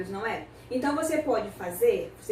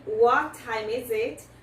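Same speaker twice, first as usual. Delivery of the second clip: slow model question; much slower than usual. Shown as a demonstration. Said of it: "a gente pode usar esta aqui".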